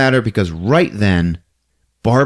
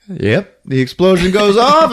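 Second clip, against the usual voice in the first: ordinary delivery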